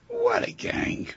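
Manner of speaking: usual speaking voice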